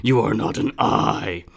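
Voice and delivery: low, slow booming monster; slow menacing planet-eater; deep monster voice. Acted. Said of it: "he says".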